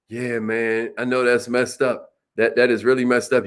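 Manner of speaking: low, soft and understanding, not sarcastic; sincere agreement. Shown as a demonstration. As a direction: agree gently, really meaning it. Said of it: "Now watch your tone of voice. You're not being sarcastic".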